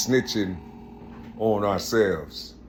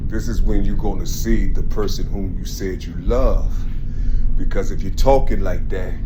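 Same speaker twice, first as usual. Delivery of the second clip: fired-up preaching; 7 dB louder than usual. Real, not acted. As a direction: preach it hard to the crowd, getting louder. Rising street preaching about God.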